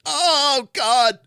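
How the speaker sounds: high, loud panicked cry; terrified gibbering. Retold or imitated. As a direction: wail it out in shock. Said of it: "I literally did this".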